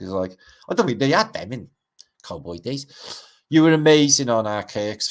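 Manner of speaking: ordinary talk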